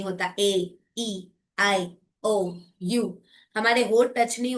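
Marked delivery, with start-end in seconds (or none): 0.4-2.6 vowels drawn out clearly, one by one; slow teacher demo of ए ई आई ओ; vowel demonstration. Shown as a demonstration. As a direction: model each pure vowel for the class. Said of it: "हवा का अवरोधन बिल्कुल नहीं".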